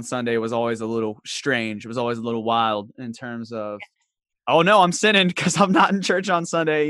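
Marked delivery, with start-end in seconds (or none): 4.5-6.9 higher, hammed-up guilty worry; mock alarm. Churchgoer missing Sunday service, mock alarm. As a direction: fret in mock panic, pitched up.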